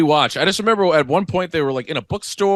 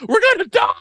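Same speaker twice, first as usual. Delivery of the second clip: high squeaky mock panic; 4 dB louder, faster than usual. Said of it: "people are like".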